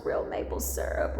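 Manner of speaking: whispered real maple syrup; a whispered real maple syrup, savoured; hushed relish. Retold or imitated. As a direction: breathe the words like a treat.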